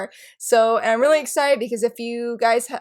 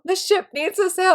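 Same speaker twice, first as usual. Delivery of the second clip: emphatic plea; 8 semitones higher than usual. Retold or imitated. Repeats plea for the ship.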